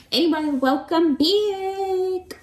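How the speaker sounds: high, loud, drawn-out greeting; singsong welcome. Real, not acted. Vlogger greeting viewers after being away, singsong welcome. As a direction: stretch it out slowly and raise the pitch.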